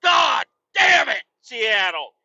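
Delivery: drawn-out angry shout; furious shout. Real, not acted. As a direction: yell it at the whole city. Rant at Seattle.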